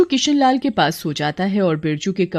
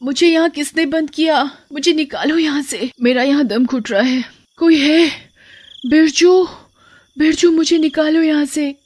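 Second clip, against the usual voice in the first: high-pitched pleading calls; trapped crying out; 7 semitones higher than usual. Acted. Trapped wife's soul begs husband for rescue, crying out high. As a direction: call out desperately to be let free. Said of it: "चिल्लाती रहती है".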